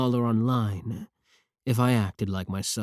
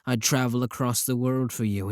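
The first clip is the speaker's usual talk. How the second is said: ordinary delivery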